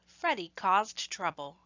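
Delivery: usual speaking voice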